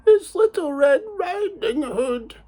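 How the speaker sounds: high, slow put-on little-girl voice; wolf disguises himself as the girl; wolf faking a girl. Wolf at Granny's door, faking a high girlish voice. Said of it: "And the wolf said".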